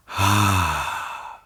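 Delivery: long audible sigh of relief. Shown as a demonstration. Teacher showing the class a relieved sigh. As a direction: let the breath go with a relieved sigh. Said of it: "release a sound of relief".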